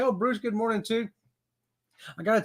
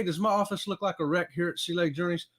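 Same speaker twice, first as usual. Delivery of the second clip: ordinary talk, nothing marked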